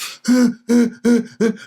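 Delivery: loud, high sobbing gasp. Retold or imitated. Boy bursting in the house crying, gasping sob. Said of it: "one of those good cries".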